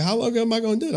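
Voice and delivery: a hint of a five-year-old asking; little daughter. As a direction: daughter asking her dad, lightly mimicked. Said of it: "She like".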